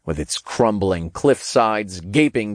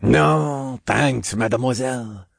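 put-on French accent; 4 semitones higher than usual. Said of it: "through a thick French accent".

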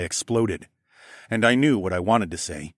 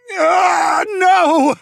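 very loud, much higher roar; thundering cry; 10 dB louder than usual. Acted. Narrator breaking the false memory, thundering. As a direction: thunder out the refusal. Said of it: "I thundered out".

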